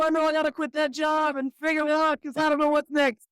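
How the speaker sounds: pleading that climbs to a high, frantic wail; hammed-up desperate bargaining with God; desperate prayer. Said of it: "But I got on my knees. I said".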